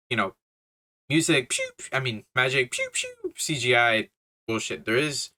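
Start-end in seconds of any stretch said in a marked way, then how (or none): 2.7-3.3 very high, soft "pew, pew" zaps; mock blaster sound. Reviewer mocking CGI magic fights with very high, soft zaps. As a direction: squeak out laser zaps.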